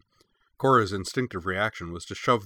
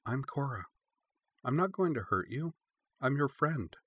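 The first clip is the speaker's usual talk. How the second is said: higher, softer, tender voice; tender promise to a scared pup; gentle reassurance; 6 semitones higher, 4 dB quieter than usual. A narrator's reading. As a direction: win the puppy over softly. Cora befriending scared puppy.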